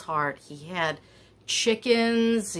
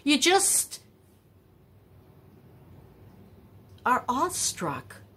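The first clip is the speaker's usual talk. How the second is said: awestruck; 7 semitones higher than usual. Real, not acted. Marveling at Maine accent.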